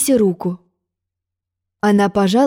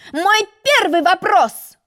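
high, slow proclaiming; a pompous egg opening his quiz; shouted announcement; 10 semitones higher, slower than usual. A narrator's reading. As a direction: announce it like a quizmaster. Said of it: "кричал".

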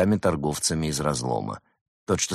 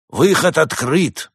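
slow, grand proclamation, louder; solemn announcement; 9 semitones higher, 5 dB louder, slower than usual. A narrator's reading. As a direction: announce it like a ceremony. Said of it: "торжественно объявил правый демон".

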